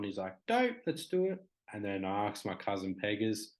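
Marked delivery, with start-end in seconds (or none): 0.5-1.4 high-pitched, excited yes; eager agreement. Retold or imitated. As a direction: jump at the invitation, pitched well up. Mate eagerly agrees to the song.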